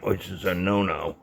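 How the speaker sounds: normal talking voice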